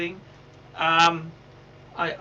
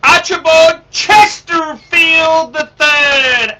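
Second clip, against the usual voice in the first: big, loud, high showman intro; booming slow host welcome; loud host greeting; 7 semitones higher, 13 dB louder, much slower than usual. Acted. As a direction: announce yourself like a live broadcast. Loud broadcaster greeting opening.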